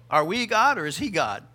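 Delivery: ordinary delivery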